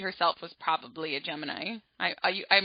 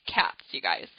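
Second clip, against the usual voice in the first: much lower, flat deadpan aside; 13 semitones lower, 4 dB quieter than usual. Retold or imitated. Narrator mocking her own cat report, low and dry. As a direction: undercut yourself with a low dry mutter. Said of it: "and I'm like".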